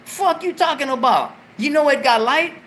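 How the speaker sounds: high, loud incredulous retort. Retold or imitated. Snapping at the girl about the trailer's light, high and loud. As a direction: scoff at her question. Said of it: "I said".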